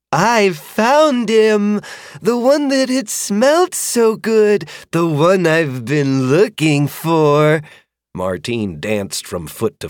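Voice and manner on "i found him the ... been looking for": slow, high creature voice, delighted; a dragon proud of her find, drawn out slowly; happy dragon. A narrator's reading. Dragon explaining her find to her rider, slow and pleased.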